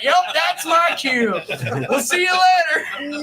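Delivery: high, loud, slow laughing shout; laughing exit line. Retold or imitated. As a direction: yell it while cracking up and bailing. Fleeing after the yell.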